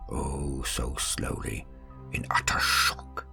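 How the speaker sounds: usual speaking voice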